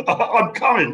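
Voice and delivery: laughing, high and slow; delighted laugh at the thought of the food. Real, not acted. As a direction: laugh through the words in delight. Host laughs at fried chicken waffles.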